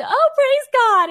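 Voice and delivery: hammed-up church lady blessing him; old lady voice. Old lady replies, imitated.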